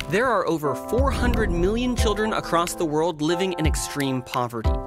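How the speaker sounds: ordinary delivery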